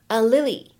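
"and Lily" with the d unreleased; "and Lily" as one smooth unit; incomplete plosion. Shown as a demonstration. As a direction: link "and Lily", dropping the d's burst. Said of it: "会有一个不完全爆破的处理".

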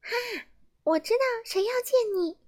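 high and fast elf voice; a mischievous elf with a secret; quick high fairy voice; sly little elf. A narrator's reading. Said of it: "小精灵说完".

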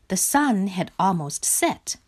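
ordinary delivery

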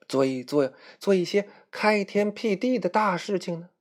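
high, rousing leader's speech; 慷慨激昂的小领袖. Acted. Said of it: "白雪公主说". (高声鼓动的白雪公主)

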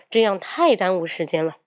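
ordinary talk, nothing marked

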